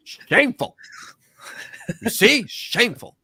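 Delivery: high, scandalized mock scolding; mock outrage. Host relishing a viewer's scolding message, high mock outrage. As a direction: cry shame in a high, put-on voice.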